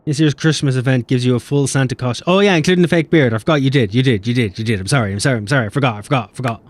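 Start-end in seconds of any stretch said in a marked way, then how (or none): none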